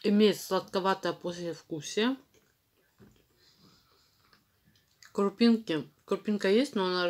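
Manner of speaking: chewing while talking; pasty mouth speech; mouth full of chalk. Real, not acted.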